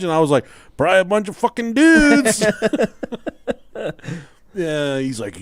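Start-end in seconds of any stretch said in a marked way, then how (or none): 0.8-2.4 high-pitched teasing jab; mocking comeback. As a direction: toss it back as a cheeky insult.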